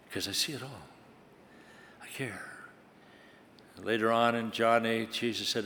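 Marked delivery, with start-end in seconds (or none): 0.1-2.4 soft whispered I care. Acted. God tells the listener he cares, whispered. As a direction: breathe it as a tender secret.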